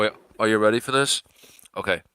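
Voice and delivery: normal talking voice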